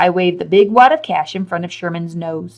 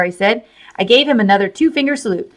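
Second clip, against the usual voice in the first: ordinary delivery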